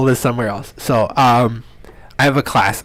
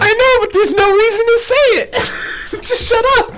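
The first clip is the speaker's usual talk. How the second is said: high, crying-laugh protest; laughing exasperation. Real, not acted. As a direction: squeal it through laughter.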